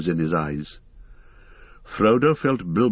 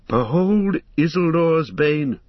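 higher, louder, slow and ringing; grand proclamation; 8 semitones higher, 4 dB louder, slower than usual. Acted. Elrond reveals the ring to the council, slowly proclaiming. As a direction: unveil it like a fateful relic. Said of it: "said Elrond".

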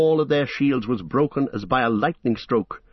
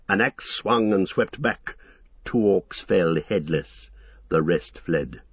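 ordinary delivery, nothing marked

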